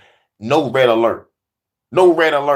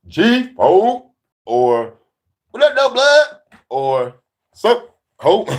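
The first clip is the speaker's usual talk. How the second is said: 1 mock gang greeting; 9 semitones higher, 4 dB louder, slower than usual.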